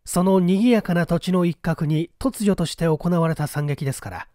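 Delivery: usual speaking voice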